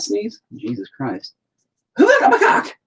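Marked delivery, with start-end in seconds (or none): 2.0-2.8 high, loud video game battle cry; squeaky arcade attack shout. Acted. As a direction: shriek it like a nineties game character attacking.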